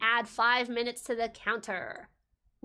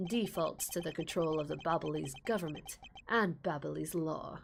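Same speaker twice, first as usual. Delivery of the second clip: lower, softer calm prosecutor voice; cool prosecutor; 6 semitones lower, 6 dB quieter than usual. Acted. Prosecutor calmly explaining embassy law to the detective. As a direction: explain it coolly, like a composed lawyer.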